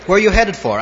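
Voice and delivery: loud call from the truck. Retold or imitated. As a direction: shout it over to the stranger. Driver calls out to cyclist.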